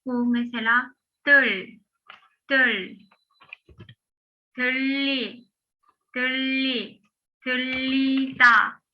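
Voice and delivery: tüllida with a long doubled L, slowed on the last take; double L demo. Shown as a demonstration. Teacher builds up tüllida step by step for students. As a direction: stretch the LL in tüllida. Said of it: "diye okuyacağız".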